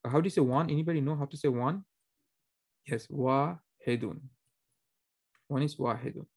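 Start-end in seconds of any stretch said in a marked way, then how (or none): none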